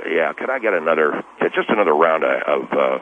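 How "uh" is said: ordinary talk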